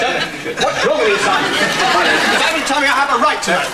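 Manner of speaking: loud, high, urgent pleading; heated, high-pitched insistence; agitated demand. Acted. Demanding to be told.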